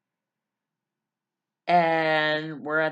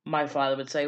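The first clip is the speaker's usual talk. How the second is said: usual speaking voice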